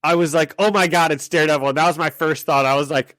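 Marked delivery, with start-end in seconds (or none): 0.6-1.7 thrilled gasp, higher and louder; excited. Retold or imitated. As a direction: blurt it out like a fan. Retells first thrilled reaction.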